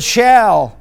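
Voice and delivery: SHALL said firmly with a falling tone; stress on SHALL. Shown as a demonstration. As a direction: lean hard on the word "shall". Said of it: "the emphasis was on the word".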